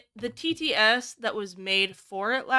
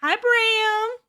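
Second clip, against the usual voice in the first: bright greeting; 12 semitones higher, 5 dB louder than usual. Real, not acted. Greeting a chat member.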